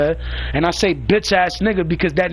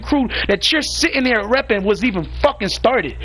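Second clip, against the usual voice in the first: angry rant; 5 semitones higher than usual. Real, not acted. Angry rant at rival leader.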